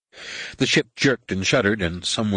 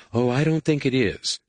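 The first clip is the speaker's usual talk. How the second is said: higher character voice for a doubtful passenger; lightly voiced Tahiri; 6 semitones higher than usual. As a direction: raise the pitch lightly for the character.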